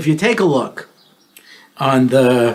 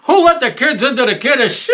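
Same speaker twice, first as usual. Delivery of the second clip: put-on grumpy old shul guy; 9 semitones higher than usual. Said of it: "the old guy sitting in the back of the shul saying".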